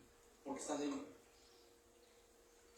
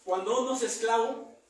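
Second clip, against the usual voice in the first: raised, louder preaching voice; 3 semitones higher, 6 dB louder than usual. Acted. Preacher warning his congregation about sin, raised preaching. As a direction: press the point on the congregation.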